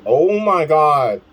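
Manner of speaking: loud, high, drawn-out exclamation; astonished outburst. Real, not acted. Reader reacting to the fight in the story, astonished. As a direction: cry out slowly in amazement.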